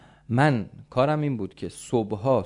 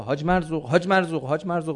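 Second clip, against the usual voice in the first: higher, louder repeated calls; urgent calling; 5 semitones higher, 4 dB louder, faster than usual. Acted. Neighbour calling upstairs to Haj Marzuq, urgent calling. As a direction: call his name out urgently.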